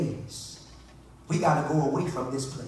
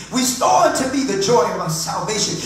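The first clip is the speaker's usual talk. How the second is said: loud, high urgent plea to God; impassioned pulpit pleading; full-force preaching prayer; 8 semitones higher, 9 dB louder than usual. Acted. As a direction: cry out to God, building in intensity.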